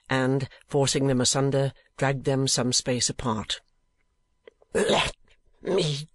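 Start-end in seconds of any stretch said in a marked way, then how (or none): 4.7-6.1 a held-back, hoarse cry; thick, hoarse voice. A narrator's reading. Sir Mulberry, restrained by the gamblers, hoarse and thick. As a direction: force it out thick and rough, straining against the men holding him. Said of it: "in a thick, hoarse voice".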